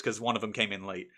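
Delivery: ordinary delivery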